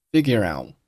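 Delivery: "figure out" ending with the t held silent; unreleased t. Shown as a demonstration. Teacher showing learners final stops, "figure out" with unreleased t. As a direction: stop "out" before the t bursts. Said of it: "out结尾的t并没有爆出来".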